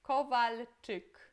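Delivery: slow, careful Polish Kowalczyk; Polish pronunciation. Shown as a demonstration. Teacher modelling Kowalczyk to students, slow and careful. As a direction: spell out each sound of Kowalczyk in Polish. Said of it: "Depois temos aqui".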